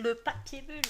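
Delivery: redit plus fort et plus haut, amusé; répété plus fort. Pretend. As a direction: lancer le mot à voix haute, ravie. Répète le mot papier bulle. (exclamation amusée à voix haute)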